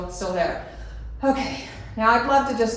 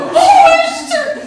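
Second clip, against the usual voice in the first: much higher, much louder, slow laughing scream; shocked yell; 13 dB louder, much slower than usual. Retold or imitated. Rat sliding down her windshield, laughing shriek. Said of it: "And I'm like".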